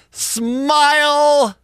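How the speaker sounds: high mock-cheerful Smile; sarcastic cheery. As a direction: sing it out with fake cheer. Sarcastic segment jingle.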